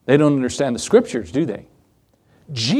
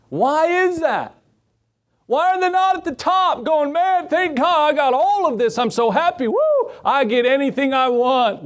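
much higher, hammed-up delighted rich man; high mock-happy voice; 10 semitones higher than usual. Preacher mocking rich folks who should be happy, high and giddy. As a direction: squeal it like a giddy millionaire.

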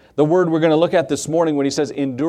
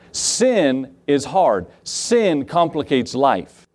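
slow emphatic preaching; 2 semitones lower, much slower than usual. Acted. Preacher hammering a point.

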